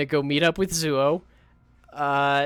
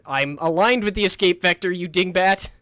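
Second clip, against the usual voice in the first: louder, higher playful scolding; exasperated snap; 5 semitones higher, 6 dB louder than usual. Real, not acted. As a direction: bark it at a dingbat. Snapping at ship or chat.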